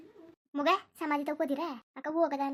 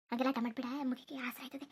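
normal talking voice

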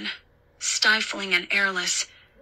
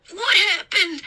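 put-on high, alarmed child voice; startled, high-pitched yelp; boy crying out; 11 semitones higher, 4 dB louder, slower than usual. A narrator's reading. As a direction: cry out in fright like a little boy. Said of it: "the boy cried".

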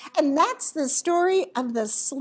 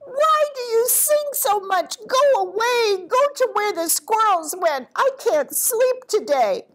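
high, loud cranky owl voice; sleepy owl grumbling at the noisy birds; grumpy owl; 8 semitones higher, 5 dB louder than usual. Acted. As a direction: shoo them off like a tired old owl. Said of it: "he was saying".

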